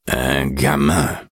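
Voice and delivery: very slow, groggy, thick-tongued; sleepy slurred voice. A narrator's reading. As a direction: drag it out half asleep. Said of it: "dit-il d'une voix pâteuse, endormie".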